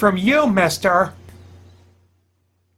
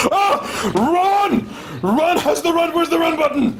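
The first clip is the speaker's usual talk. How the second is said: high frightened cry to run; panicked shout; 8 semitones higher than usual. Real, not acted. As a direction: flee in panic. Fleeing monster in game.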